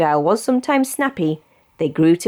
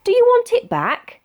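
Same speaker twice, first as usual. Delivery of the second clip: mouse voice. A narrator's reading. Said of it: "answered the mouse".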